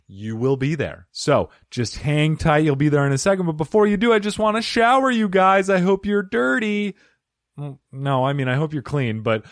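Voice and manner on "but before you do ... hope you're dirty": playful flirt. Pretend. Flirty compliment shower.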